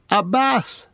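high excited cry of joy; delighted cry. A narrator's reading. Pippin spots the steaming bath, high delighted cry. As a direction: Pippin can't believe his luck. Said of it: "cried Pippin".

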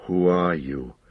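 low, menacing demand; sinister dark lord. A narrator's reading. Dark lord demanding the hobbit's name, menacing.

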